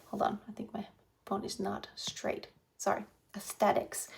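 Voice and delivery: breathy whisper off to the side; whispered aside. Real, not acted. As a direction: drop to a whisper for a quick aside. Aside about phone camera.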